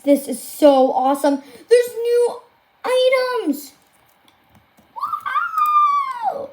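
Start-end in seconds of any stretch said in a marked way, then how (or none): 1.7-3.7 high-pitched happy cry; excited squeal. Real, not acted. Streamer finds new items in the update, excited squeal. 4.9-6.5 extremely high, drawn-out squeal; amazed squeal. Real, not acted. Streamer marvels at the new items, amazed squeal.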